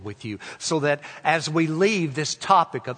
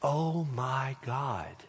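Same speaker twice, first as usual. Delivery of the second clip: slow, hushed awe; quiet, drawn-out reverence; reverent realization; 8 dB quieter, much slower than usual. Shown as a demonstration. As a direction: breathe it out slowly and softly, as to God. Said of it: "But if you say it".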